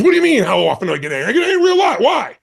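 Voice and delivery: fast mock-angry retort, jumping high and loud; imitated angry woman. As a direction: act out her indignant outburst, pitch shooting up. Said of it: "talking so angrily".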